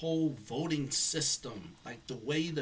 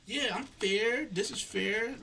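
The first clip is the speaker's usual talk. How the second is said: lightly mimicked claim, pitched higher; imitated fairness claim; 8 semitones higher, slower than usual. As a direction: mimic their assurance in a higher voice. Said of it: "if they want people to believe that".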